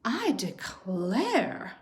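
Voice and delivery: slow, drawn-out exclamation; slow, genteel mock dismay; mama bear exclaiming. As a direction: draw it out like a flustered mother bear. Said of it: "Mama Bear exclaimed".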